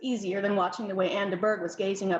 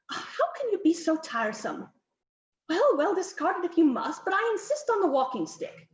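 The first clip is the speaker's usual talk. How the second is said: higher, insistent countess ordering poses; bossy countess; 6 semitones higher than usual. Acted. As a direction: countess fussing over the portrait, lightly voiced.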